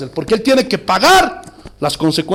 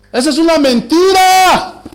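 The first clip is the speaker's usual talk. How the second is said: emphatic denunciation; 5 semitones higher, 4 dB louder than usual. Real, not acted. Preacher denouncing a false promise to the congregation, forceful cry. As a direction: denounce it loudly, stretching the final word.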